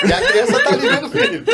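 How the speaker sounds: high-pitched laugh through the words; giggling while talking. Real, not acted. Laughing through the funny image.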